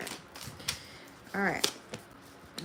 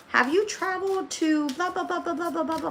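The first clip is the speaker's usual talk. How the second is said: higher-pitched mock officer asking questions; mock official questioner; 10 semitones higher than usual. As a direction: imitate a bored screening official. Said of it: "one of the questions that they ask you".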